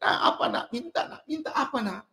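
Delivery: put-on a father soothing his sulky kid, softly; hushed fatherly coaxing. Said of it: "bapaknya sampai bilang".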